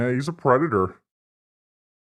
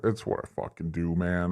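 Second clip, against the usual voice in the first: usual speaking voice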